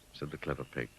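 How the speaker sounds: ordinary delivery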